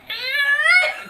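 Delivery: high squealing noise; imitated peeling noise. Game master imitating Kibble pulled off a shield, high squeal. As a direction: squeal like something being peeled off. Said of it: "it makes a nice, like".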